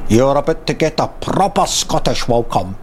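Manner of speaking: a light Scottish menace; a hint of Scottish threat; Scottish voice line. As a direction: growl it like a Scottish villain. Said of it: "a kinda Scottish".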